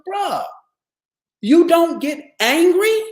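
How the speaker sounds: high, loud, slowed disbelief; a kid who can't believe it; amazed teen. Retold or imitated. Fifteen-year-old asks his teacher, high and amazed. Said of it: "he goes".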